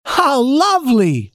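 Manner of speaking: slow, high, charmed doll voice; delighted doll voice. A narrator's reading. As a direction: coo it like delighted dolls. Dolls delighted.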